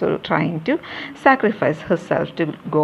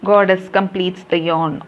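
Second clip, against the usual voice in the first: ordinary delivery, nothing marked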